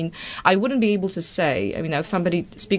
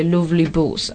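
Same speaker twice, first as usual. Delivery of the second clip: put-on Yorkshire accent. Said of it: "she would say".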